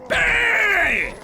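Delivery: a huge high shouted BANG like a gunshot. Pretend. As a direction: blast it out as a yelled gunshot. Shooting the enemy in game.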